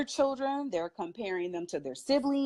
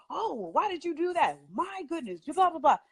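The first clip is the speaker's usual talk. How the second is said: mock parental scolding, higher and exasperated; overreacting parent; 6 semitones higher than usual. Parents reacting when a child slips up, mock scolding. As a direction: mimic a fussing parent, pitched high. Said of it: "Parents are so".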